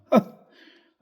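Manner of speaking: loud hearty laugh; a big ringing laugh at his panic; doctor laughing heartily. Acted. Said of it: "He broke into a ringing laugh".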